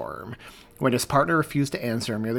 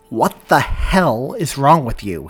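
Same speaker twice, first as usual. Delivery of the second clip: loud and slow, right in his face; shouting in his face; 3 semitones higher, 11 dB louder, slower than usual. Acted. Partner pinning cop against a wall, shouting in his face. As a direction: bellow each word at him. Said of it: "he shouted in his face".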